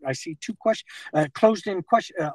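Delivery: ordinary talk, nothing marked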